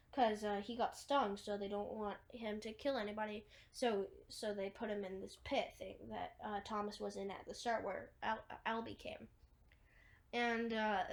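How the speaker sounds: ordinary delivery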